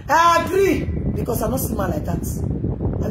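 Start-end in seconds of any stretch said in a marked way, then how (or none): 0.1-0.8 1 emphatic shout. Real, not acted. Repeated, second shouted.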